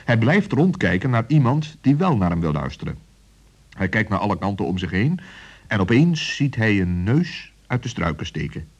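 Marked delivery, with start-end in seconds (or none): none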